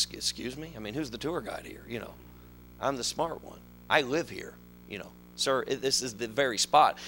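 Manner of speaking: lightly voiced guide, low and very soft; tour guide. Tour guide answering a doubting tourist, quietly condescending. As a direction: quietly put the challenger in his place.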